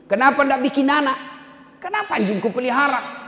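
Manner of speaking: high-pitched retold challenge; incredulous questioning. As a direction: ask as if it makes no sense. Retold incredulous question to dog owner. (bertanya heran dengan nada tinggi)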